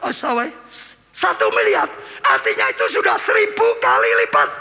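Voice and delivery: high, shouted sermon climax; preaching at full force. Acted. Pastor to congregation, shouting sermon climax.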